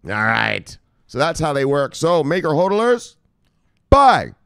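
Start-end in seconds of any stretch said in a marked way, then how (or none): 2.0-4.3 rising to a loud high yell of buy; hype shout. Real, not acted. Crypto vlogger yelling at his viewers to buy. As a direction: pump up the crowd on the last word.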